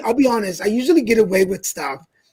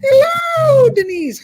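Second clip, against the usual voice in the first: high squealed hello; a hammed-up cheery greeting; mock greeting; 4 dB louder, much slower than usual. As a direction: sing out the hello in a put-on high voice.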